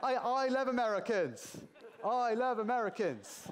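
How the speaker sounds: high, drawn-out proclamation over laughter; mock declaration. Lecturer placating the audience after a joke, mock declaration. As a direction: proclaim it slowly, playfully overdone.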